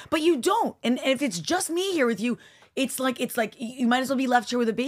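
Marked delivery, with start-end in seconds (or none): 0.1-2.4 retold angry retort. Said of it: "and I'm like".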